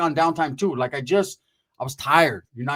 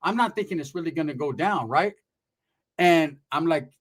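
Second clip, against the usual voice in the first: ordinary delivery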